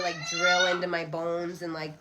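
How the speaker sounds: ordinary talk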